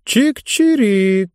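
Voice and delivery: high, slow chirping; bird chirp. A narrator's reading. Caged bird eyeing the banknotes, slow high chirp. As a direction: chirp it like a curious little bird.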